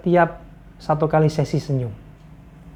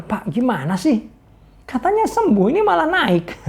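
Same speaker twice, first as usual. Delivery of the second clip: much higher, indignant grumbling; grumbling complaint; 7 semitones higher than usual. Retold or imitated. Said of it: "dia ngomel-ngomel". (mengomel dengan suara jauh lebih tinggi)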